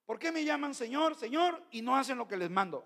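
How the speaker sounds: calling out. Acted. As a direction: call out a pointed question to the people. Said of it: "y les dijo".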